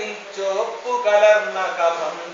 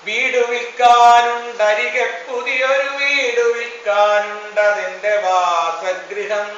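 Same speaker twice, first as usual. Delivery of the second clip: raised, ringing verse recitation; proclaimed refrain; 3 dB louder than usual. Acted. Poet announcing the new house for sale, proclaiming. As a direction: proclaim it, lifting the first half and settling at the end.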